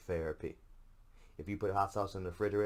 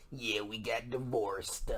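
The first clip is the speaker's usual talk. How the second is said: imitating the wrestler; 4 semitones higher, slower than usual. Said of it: "I think it's when he does".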